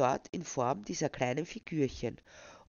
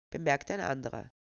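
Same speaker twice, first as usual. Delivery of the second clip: usual speaking voice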